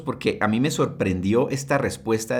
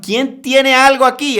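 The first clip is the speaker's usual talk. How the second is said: calling out; 13 semitones higher, 9 dB louder than usual. Acted. Apostles calling out to crowd.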